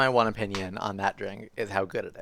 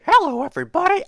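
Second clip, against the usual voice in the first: squeaky high furry puppet voice, much higher; high, louder puppet greeting; Grover voice attempt; 8 dB louder, faster than usual. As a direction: try a Muppet voice and give up. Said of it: "tried to do the Grover voice".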